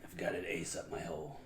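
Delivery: low, quiet character voice; Gambit. Acted. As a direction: drop it low and soft, in character. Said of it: "Can you give us a good Gambit impression?".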